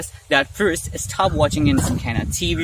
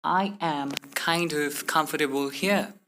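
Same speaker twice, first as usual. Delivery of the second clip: slow, "kind" and "of" kept apart; word-by-word; 6 dB quieter, slower than usual. Shown as a demonstration. Teacher showing what not to say, word-by-word. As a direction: separate every word carefully. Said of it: "Instead of saying".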